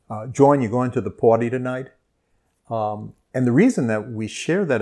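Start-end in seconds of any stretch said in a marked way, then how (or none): 0.2-1.9 put-on louder call in a New Orleans Brooklyn-like accent; aunts' New Orleans accent. Aunt calling her nephew about the party, in a New Orleans accent. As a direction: call out like his aunts in Gentilly, with their accent. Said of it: "all call me John".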